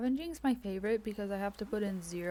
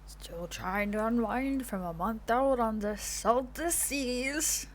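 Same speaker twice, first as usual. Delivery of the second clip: put-on slow, louder old sea dog voice; fisherman voice; 6 dB louder, much slower than usual. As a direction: drawl it out like a weathered fisherman. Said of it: "That's my fisherman voice".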